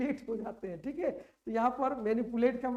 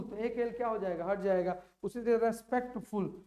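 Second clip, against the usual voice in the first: usual speaking voice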